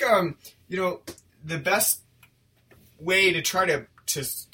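ordinary delivery

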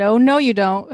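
loud acted warning; mock warning shout. Co-host quotes him catching her, mock warning shout. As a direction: punch it out as a loud mock order.

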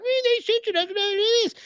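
very high squeaky mock whine; mock whiny complainers. People mad at Facebook whining about censorship, mocking high whine. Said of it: "They're like".

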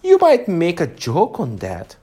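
a lightly voiced tiny insect; a hint of a small creature's voice. A narrator's reading. Tiny gnat at Alice's ear, lightly voiced. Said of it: "said the little voice close to her ear".

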